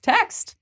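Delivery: text lifted way up high; stress on text. Real, not acted. Friends insisting you must text back, text lifted high. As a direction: sing the word text up.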